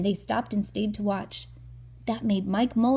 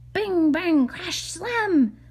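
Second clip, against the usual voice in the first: louder and higher with each bang; building excitement; 9 semitones higher, 5 dB louder than usual. Acted. Reader building the digging noise to a loud climax. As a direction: let it grow louder and louder.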